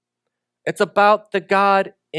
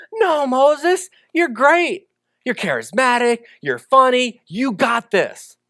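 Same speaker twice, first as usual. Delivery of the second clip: higher, upbeat pep talk, slowing at the end; mock cheerleader God; 6 semitones higher than usual. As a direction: hype him up like an eager coach. Imagined God flattering Moses.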